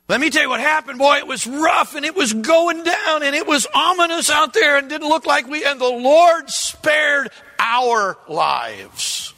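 high, loud, urgent retelling; dramatic storytelling. Pretend. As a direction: tell it like a survivor. Retold survivor's dramatic tale.

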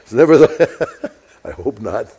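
chuckling through the words; a laugh breaks in as he speaks. Real, not acted. Preacher laughs at his own remark to the congregation, laughing.

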